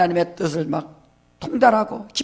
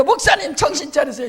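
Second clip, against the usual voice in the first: much higher, mock-frustrated plea; exasperated deacon; 7 semitones higher than usual. Pastor mimics a frustrated deacon, much higher pitched. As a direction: squeal it like a fed-up deacon. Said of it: "자기가 볼 때 목사님 답답하거든".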